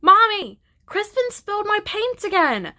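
put-on high, loud child's shriek of complaint. A narrator's reading. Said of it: "shrieked Flora".

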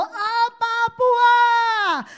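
high, loud cry to the Father; anguished cry. Retold or imitated. Pastor voices Jesus before the cross, crying out loud. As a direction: cry it out in agony. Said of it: "他都说".

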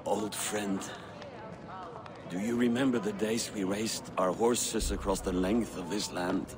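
low, tender last words; sad farewell. Acted. Dying friend's farewell.

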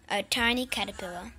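ordinary delivery, nothing marked

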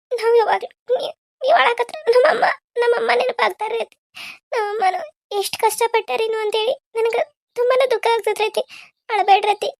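high, crying pleas; tearful. Acted. Daughter-in-law weeps recalling her own mother. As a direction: cry while comforting her.

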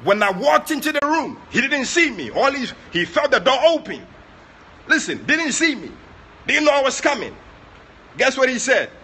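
rising sermon fervor; full-force preaching. Acted. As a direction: drive the story home from the pulpit. Preacher building the story.

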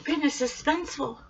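ordinary delivery, nothing marked

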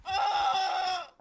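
screaming rage, high and loud; raging gamer. Real, not acted. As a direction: yell it in total fury. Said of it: "He literally yells every time".

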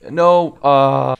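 sudden loud shout of alarm. Real, not acted. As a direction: yell it as the boss hits. Sudden danger in boss fight.

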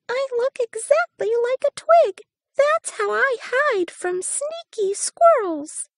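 very high, fast squeaky voice; walking stick voice. A narrator's reading. Walking stick teases the hare, very high and quick. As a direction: chirp it quick like a tiny twig bug.

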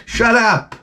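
hammed-up heckler's put-down; mock bullying. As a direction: cut off the pleading man. Tormentor silencing the coughing man.

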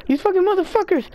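high-pitched freaked-out yell; panicked scream. Real, not acted. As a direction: scream in real panic at the screen. Panicked gaming outburst, screaming.

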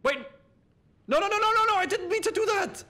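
high panicked no no no; frantic, high, can't undo it. Real, not acted. Panicked gamer mistake.

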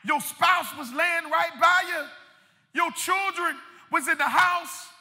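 impassioned preacher's cry; preaching at full force. Real, not acted. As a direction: drive each phrase out to the congregation.

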